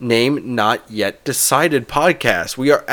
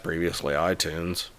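"previously iTunes" said much lower and softer; dropped lower; 7 semitones lower, 12 dB quieter than usual. Real, not acted. Host drops his voice naming the old app name. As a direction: tuck it away as a quiet aside. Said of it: "Why do you get lower there?".

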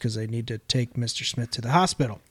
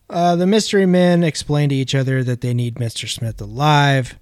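usual speaking voice

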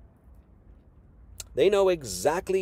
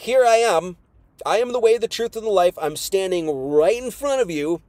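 raised, louder declaring voice as Jesus; voicing Jesus; 5 semitones higher, 5 dB louder than usual. Preacher voicing Jesus to the Pharisees, raised and insistent. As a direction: proclaim it like Jesus standing before them.

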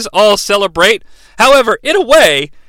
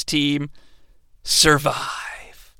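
whisper; 6 semitones lower, 10 dB quieter, much slower than usual. Acted. Dramatic whispered ending.

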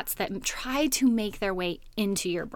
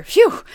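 loud, high whew of relief; acted-out sigh of relief, high and loud; relieved phew; 11 semitones higher, 11 dB louder than usual. Relieved it is no robber.

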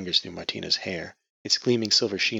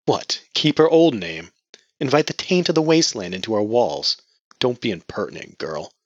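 stern rebuke; 4 semitones higher, 7 dB louder than usual. Acted. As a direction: scold her with contempt. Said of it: "silenced her with a glare".

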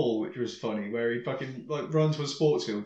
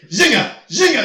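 loud high repeated shout; yelled product name; 9 semitones higher, 10 dB louder than usual. Retold or imitated. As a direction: bellow it while throwing food. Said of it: "He's just going".

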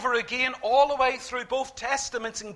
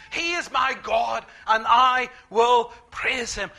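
slow, loud preaching of the verse; proclaiming scripture; 4 dB louder than usual. Acted. Preacher reads Moses' song to the church, slow and loud. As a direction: declare each phrase slowly to the congregation.

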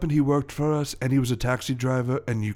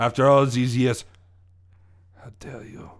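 put-on wounded French-accented indignation. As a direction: act slighted in a French accent. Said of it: "my French accent".

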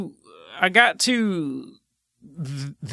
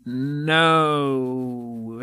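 drawn out; 4 semitones lower than usual. Real, not acted. Drawn-out reply to a question.

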